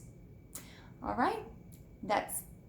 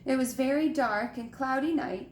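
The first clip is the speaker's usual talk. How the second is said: ordinary delivery, nothing marked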